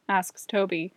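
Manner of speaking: ordinary delivery, nothing marked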